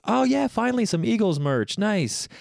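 high, sarcastic praise of the gift; mock enthusiasm. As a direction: play up fake delight.

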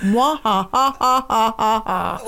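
low, spelled-out villain cackle; hammed-up "mwah-ha-ha"; mock evil laugh. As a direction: laugh like a pantomime villain, evenly spaced. Villain laugh ends the rant.

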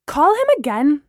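loud, high-pitched order; commanding. A narrator's reading. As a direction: give it as an urgent command. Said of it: "Gemma commanded".

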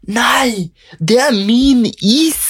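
high, piercing, slowed shriek; retold shriek. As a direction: shriek it like she's being robbed. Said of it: "hun skriker ut".

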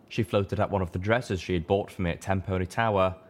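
ordinary talk

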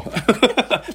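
bright surprised laugh; laugh, caught off guard. Real, not acted. Touched by unexpected compliment.

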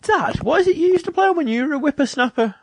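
high mock child voice; imitating a kid. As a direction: pitch way up like a curious kid. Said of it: "or she'll be like".

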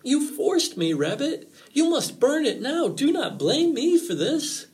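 high, agitated protest; emphatic, pleading warning, slowing at the end; urgent fox. A narrator's reading. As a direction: push the blame away, pitch way up. Fox disclaims blame.

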